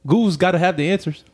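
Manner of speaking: like a lightbulb moment; excited realization. Retold or imitated. Retold thought, sudden idea.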